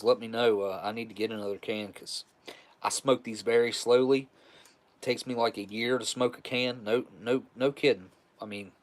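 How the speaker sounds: usual speaking voice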